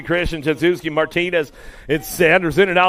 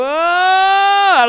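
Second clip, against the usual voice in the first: much higher, louder, stretched slow; drawn-out call; 12 semitones higher, 5 dB louder than usual. Acted. Announcer calling the start, long excited call. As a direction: hold the words long like a stadium call.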